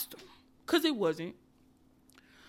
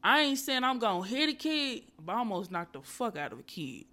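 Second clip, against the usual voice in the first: usual speaking voice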